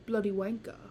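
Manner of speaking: playful put-on British swearing; hammed-up British English; mock British accent. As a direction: curse in a fake British accent. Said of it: "in a British accent".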